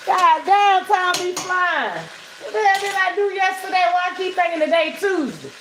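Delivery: ordinary talk, nothing marked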